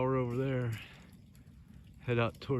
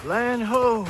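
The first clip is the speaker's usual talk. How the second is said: high, loud call of a lookout; shouting from crow's nest; 10 semitones higher, 5 dB louder than usual. Pretend. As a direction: sing out like a sailor sighting land. Spotting the road from ridge.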